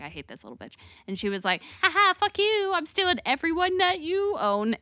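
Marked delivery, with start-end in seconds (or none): 0.0-0.7 low, much softer mock sneer; low muttered hate. Slave owner quoted in story. 1.8-4.8 high, cocky mock jeer; mocking taunt. Harriet taunting in retelling.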